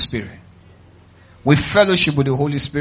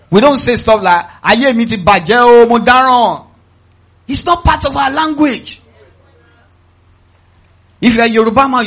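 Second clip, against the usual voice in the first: raised, high, forceful preaching voice; emphatic preaching; 7 semitones higher, 8 dB louder than usual. Real, not acted. Preacher banning negative talk in this family, emphatic preaching.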